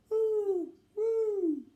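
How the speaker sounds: high, loud spooky hoot; ghostly woo. Acted. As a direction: moan like a ghost in the wind. Ghostly wind calling.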